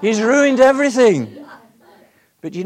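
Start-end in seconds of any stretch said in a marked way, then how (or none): 0.0-1.4 distraught outcry. Retold or imitated. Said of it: "She said".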